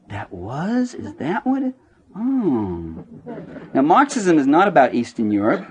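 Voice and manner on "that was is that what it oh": mock dawning surprise. Reader finishing the book, soft mock surprise. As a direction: play a reader quietly astonished. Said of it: "you want to say".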